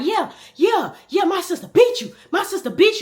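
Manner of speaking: put-on high, loud gloating kid voice; brother taunting. Brother taunting the girl, high and gloating. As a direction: rub it in her face. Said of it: "my brother selling out".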